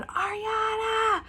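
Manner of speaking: high, long, drawn-out call of her name; mock zombie horde yell; screaming her name. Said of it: "They're, like, screaming".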